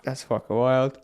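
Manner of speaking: words stretched by a yawn. Real, not acted. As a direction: talk through a yawn. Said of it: "I keep yawning".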